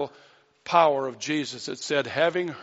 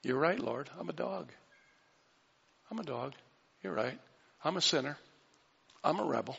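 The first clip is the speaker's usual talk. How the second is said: much softer, broken admission; humble confession; 6 dB quieter than usual. Acted. Woman confessing to Jesus, very soft and humble. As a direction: murmur each line, humbled.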